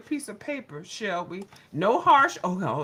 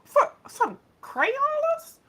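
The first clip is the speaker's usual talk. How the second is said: incredulous indignation; 8 semitones higher, 4 dB louder, slower than usual. Real, not acted. Indignant at accusation.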